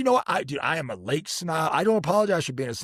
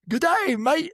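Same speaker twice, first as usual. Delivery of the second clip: high, hammed-up Aussie greeting; cartoonish Australian call-out; Australian accent; 13 semitones higher, 3 dB louder, slower than usual. Shown as a demonstration. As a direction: put on a bad, high-pitched Australian accent. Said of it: "I just went Australian".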